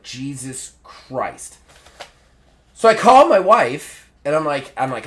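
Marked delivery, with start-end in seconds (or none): 0.0-1.6 slow, hushed exasperation; muttered exasperation. Retold or imitated. Patient stuck waiting past an hour, quiet exasperation. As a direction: draw it out quietly, worn down by the wait. Fed up with long wait.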